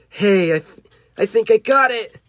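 high-pitched eager cry; high acted excitement; excited exclamation. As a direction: call out excitedly as the hero. Character excited at catching creature.

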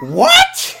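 very high, loud yelp of disbelief; high-pitched shouted question; shocked shriek. Real, not acted. Cohost reacts in disbelief to revelation.

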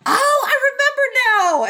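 high, laughing character voice; squeaky high quote with a laugh; mock Wei Wuxian. Retelling Wei Wuxian's line.

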